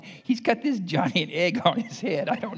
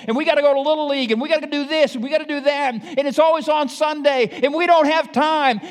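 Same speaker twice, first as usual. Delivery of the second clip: mock busy parent excuses; 10 semitones higher, 7 dB louder, faster than usual.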